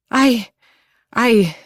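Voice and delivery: startled, broken high stammer. A narrator's reading. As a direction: trip over the word in fright. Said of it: "she stammers".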